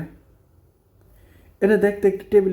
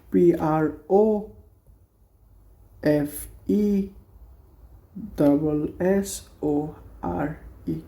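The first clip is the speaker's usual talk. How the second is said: very slow, softer letters; careful dictation; slow spelling; 4 dB quieter, much slower than usual. Shown as a demonstration. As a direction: spell প্রফেসরে for learners. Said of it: "spelling out".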